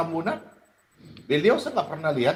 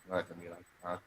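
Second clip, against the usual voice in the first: tired mumble; 11 semitones lower, 18 dB quieter than usual. Real, not acted.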